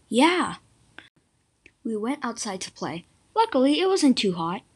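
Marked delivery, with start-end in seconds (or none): 0.1-0.6 higher, louder storybook cheer; acted yell. A narrator's reading. Brother Joe agreeing to go out and play, acted yell. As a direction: yell it back in one short burst. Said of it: "Joe yelled".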